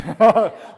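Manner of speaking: loud, high burst of laughter. Retold or imitated. Laughing loudly while imagining his father throwing stones. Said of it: "이렇게".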